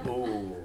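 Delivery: "oh" stretched out in Northumbrian; long Northumberland vowel. Shown as a demonstration. Joking it lasts all afternoon, drawn-out "oh". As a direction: hold the "oh" as a long local vowel. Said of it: "it can last all afternoon".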